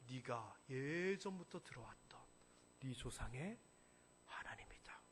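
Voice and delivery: hushed whisper. Acted. God's voice sinking low.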